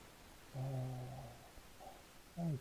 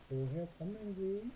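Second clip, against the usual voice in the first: slow model, rising; 5 semitones higher, 5 dB quieter, much slower than usual. Shown as a demonstration. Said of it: "당신 어디에서 왔습니까".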